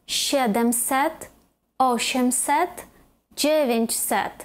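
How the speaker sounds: SIEdemset, Osiemset, DZIEwięćset, spaced apart; third-from-last stress. Shown as a demonstration. Teacher listing the hundreds, first-syllable stress. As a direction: hit the first syllable of "сімсот, осімсот, дев'ятсот" said in Polish. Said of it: "а на третій".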